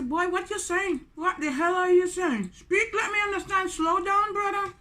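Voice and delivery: high-pitched baffled protest; acted exasperation. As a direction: demand clarity as if you can't follow a word. Acting bafflement at son's talk.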